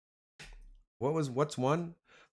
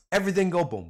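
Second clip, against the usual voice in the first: bigger, louder hype call; 8 semitones higher, 8 dB louder, faster than usual. Real, not acted. Hyping the boys in chat, louder call. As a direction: shout it out to the chat.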